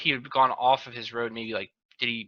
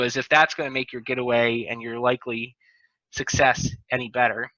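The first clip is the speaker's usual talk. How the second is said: usual speaking voice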